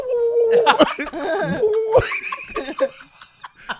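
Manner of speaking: loud high laugh. Real, not acted. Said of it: "It'd be like".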